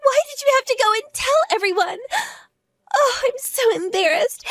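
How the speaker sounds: high, sobbing complaint; tearful wail. Acted. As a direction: cry out the shame in a high voice. Humiliated in front of mothers.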